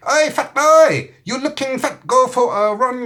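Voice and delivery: high-pitched mock Chinese accent, slowly drawn out at first. Watch insults its owner the morning after, high mock Chinese accent. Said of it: "doing a Chinese accent with it".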